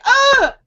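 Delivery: high, loud mock yelp; imitated startled yelp. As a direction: imitate the intruder's startled cry. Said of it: "And he's like".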